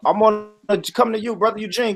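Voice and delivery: normal talking voice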